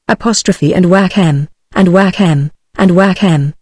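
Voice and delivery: plain reading voice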